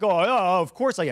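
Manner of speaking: hammed-up guilty excuse; mock defensive.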